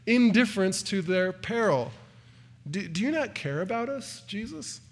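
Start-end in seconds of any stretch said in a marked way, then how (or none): 2.7-4.8 softer, fading to a hurt question; cutting reproach. Acted. Disciples in the storm accusing Jesus, soft hurt reproach. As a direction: ask as if he never cared at all. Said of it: "a cutting insult".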